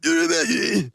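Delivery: high, loud, hammed-up cartoon-dub shout; mock the English dub, yelling it out; imitating Kuwabara. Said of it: "Because you talk like this".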